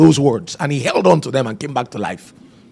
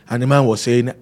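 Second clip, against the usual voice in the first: usual speaking voice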